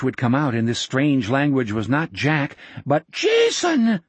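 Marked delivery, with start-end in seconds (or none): 3.1-4.0 high, loud cry of awe; awed cry. A narrator's reading. Old man recognizing Jack as Jason, awed cry. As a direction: cry the name out in wonder. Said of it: "the old man cried".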